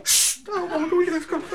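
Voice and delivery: high hushing neighbour; neighbours shushing. Retold or imitated. The neighbours hushing the roaring lion, high shush.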